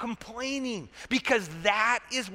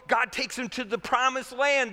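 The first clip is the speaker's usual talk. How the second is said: usual speaking voice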